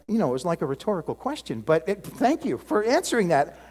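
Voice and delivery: normal talking voice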